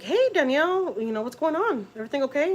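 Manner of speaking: high-pitched, lilting check-in; lightly acted "everything okay?" call; retold phone greeting. Caller greets girlfriend on the phone.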